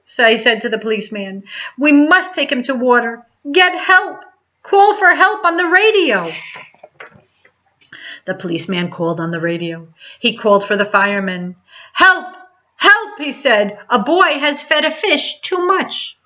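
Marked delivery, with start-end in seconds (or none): 1.8-6.3 much higher urgent orders; pressing the policeman to act; boy urging the policeman to radio for help, much higher. A narrator's reading. 11.9-16.2 calling for help. A narrator's reading. Policeman radios firemen.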